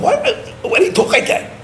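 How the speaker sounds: high-pitched, stammered mock bewilderment; acted-out puzzled protest; retold incredulous challenge. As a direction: question them in high, halting disbelief.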